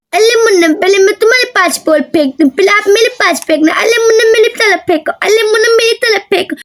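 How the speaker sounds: put-on childish baby-talk lisp; lisping little girl. Daisy plays ball with baby.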